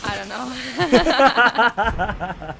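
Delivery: slow words breaking into laughter; real embarrassed laughter. Real, not acted. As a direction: laugh it off after a failed attempt. Laughs at own failed accent attempt.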